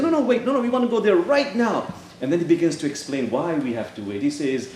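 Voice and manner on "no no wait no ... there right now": urgent protest. Acted. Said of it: "I say".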